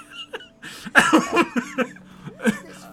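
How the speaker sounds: high, long burst of laughter; helpless laughing fit; big laugh. Real, not acted. Streamer laughing at her own comic story, high laughter.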